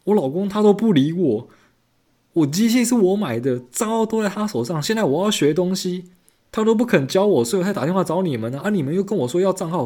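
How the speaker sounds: high, whiny complaining wife; mock lament of the caller about her husband; imitating customer. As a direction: pitch it way up and moan about the husband.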